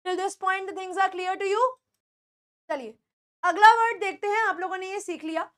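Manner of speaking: ordinary delivery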